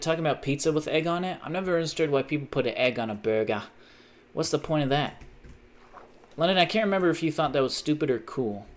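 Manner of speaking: ordinary delivery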